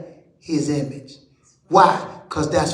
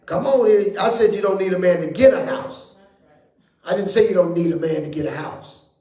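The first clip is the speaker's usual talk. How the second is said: ordinary delivery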